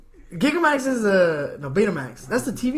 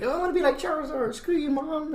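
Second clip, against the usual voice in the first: high, softer mock child voice; bratty kid; 9 semitones higher, 5 dB quieter, faster than usual. Kid defying Mom to copy Charizard, mock bratty. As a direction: play a rebellious kid in a high voice. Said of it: "cause kids to start disobeying their parents".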